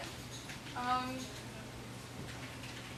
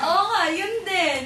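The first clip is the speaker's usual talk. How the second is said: laughing; 4 semitones higher, 6 dB louder, slower than usual. Real, not acted. Laughing agreeing.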